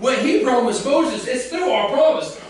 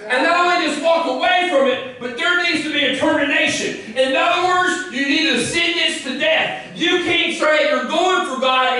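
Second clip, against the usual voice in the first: preaching at full force; 4 semitones higher than usual. Acted. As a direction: pound home the call to die to old ways. Preaching God's promise, full force.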